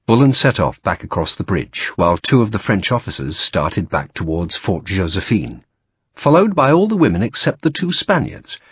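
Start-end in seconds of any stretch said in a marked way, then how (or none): none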